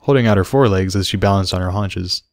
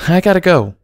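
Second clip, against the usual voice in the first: higher, louder hurried mare; lightly voiced rushing mother; Derpy voice; 10 semitones higher, 3 dB louder than usual. A narrator's reading. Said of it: "Derpy said".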